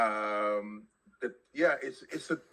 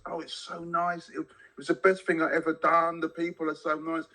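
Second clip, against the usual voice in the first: imitating gushing ex-Londoners, higher; gushing comment; 6 semitones higher than usual. Mocking friends who moved out of London, gushing and higher. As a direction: lay on the delight, pitched up. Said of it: "I get the same comments".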